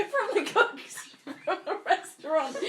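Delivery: high slow words through laughter; laughing correction. Real, not acted. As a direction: fix the joke while laughing.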